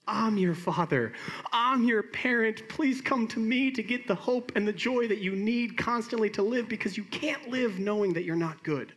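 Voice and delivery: high, impassioned fatherly plea; God the Father. Acted. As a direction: plead urgently, pitched well above normal. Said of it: "hear from God the Father that says".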